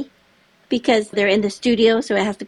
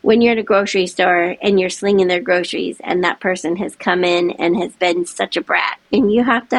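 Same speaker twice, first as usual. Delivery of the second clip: ordinary delivery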